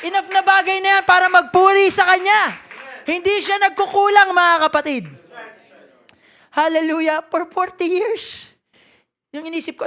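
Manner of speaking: ordinary talk, nothing marked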